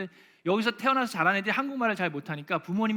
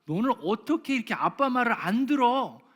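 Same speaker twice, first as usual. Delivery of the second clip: scolding dad; 6 semitones higher, 5 dB louder than usual. Retold or imitated. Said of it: "종종 듣는 이야기".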